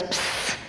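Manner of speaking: sharp whispered "psst", done twice; secretive attention-getting psst; imitated psst. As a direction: hiss a furtive psst for attention. Fourth secret call in class.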